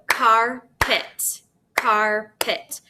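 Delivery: "carpet" in two slow separate beats, car, pet; clapped-out syllables. Shown as a demonstration. Teacher clapping out a word for pupils, syllable by syllable. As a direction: break "carpet" after the car, twice over. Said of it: "I'm gonna first clap it out".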